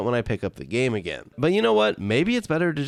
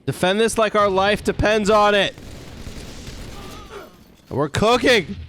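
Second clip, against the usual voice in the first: hyped; 8 semitones higher, 3 dB louder than usual. Real, not acted. Hyped gaming moment.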